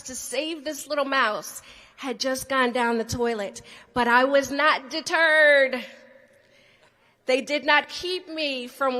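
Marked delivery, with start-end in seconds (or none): 3.9-9.0 defiant declaration. Real, not acted.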